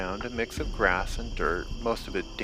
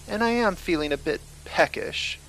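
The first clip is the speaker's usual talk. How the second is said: woman's voice; 9 semitones higher than usual. A narrator's reading. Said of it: "a woman voice rang out".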